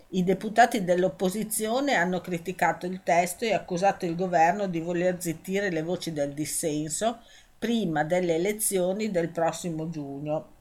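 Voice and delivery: ordinary talk, nothing marked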